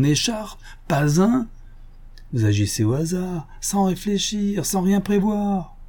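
lightly voiced gentleman thief, teasing; mock friendly, toying with him; sly Lupin. Lupin teases the inspector about the silk, lightly voiced.

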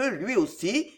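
plain reading voice

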